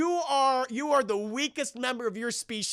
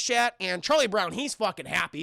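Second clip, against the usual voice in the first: ordinary delivery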